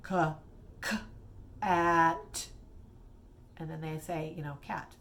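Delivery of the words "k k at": lightly robotic, sounds split apart. Shown as a demonstration. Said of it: "I'm gonna sound like a robot".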